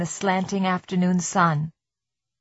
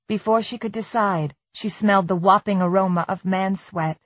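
ordinary delivery, nothing marked

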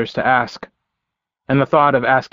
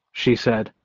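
plain reading voice

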